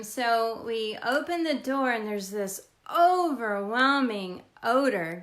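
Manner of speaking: ordinary talk, nothing marked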